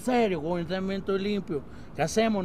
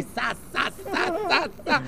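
swishy walk sound; 9 semitones higher, much slower than usual. Acted. Mimicking swishing walk in heels.

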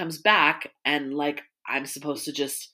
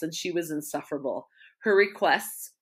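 ordinary talk, nothing marked

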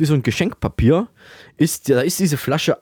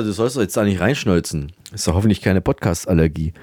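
ordinary talk

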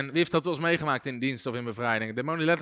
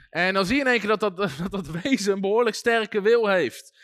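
normal talking voice

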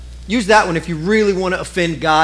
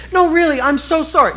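pleading apology; 8 semitones higher than usual. Retold or imitated. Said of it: "she was just like".

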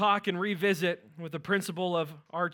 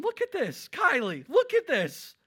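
high, loud, thrilled retelling; excited; 11 semitones higher, 7 dB louder than usual. Retold or imitated. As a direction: call her over, overjoyed. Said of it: "I'm going".

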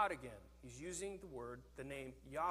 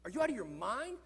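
frustrated; 4 semitones higher, 6 dB louder than usual. Retold or imitated. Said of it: "In frustration, he's like".

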